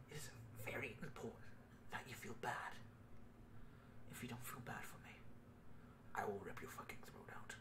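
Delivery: hushed, creepy whispered threat; whispering. Acted. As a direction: whisper it like a menacing stalker. Mock-menacing plea to viewers.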